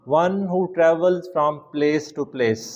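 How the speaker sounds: slow dictation. Shown as a demonstration. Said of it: "slow dictation of meaning".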